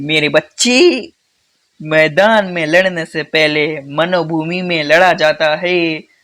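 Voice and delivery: slow, drawn-out storybook elder's counsel; Shakuni Mama. Acted. Teacher quoting a Mahabharat uncle's advice, slow mock-solemn delivery. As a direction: intone it slowly, like an epic character advising. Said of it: "Shakuni मामा को जानते हो".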